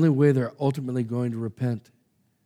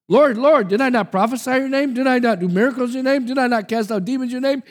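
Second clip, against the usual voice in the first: high, fast, desperate pleading; frantic voice of the rejected; pleading false prophets; 10 semitones higher, 5 dB louder than usual. Acted. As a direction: beg the Lord in a rising rush.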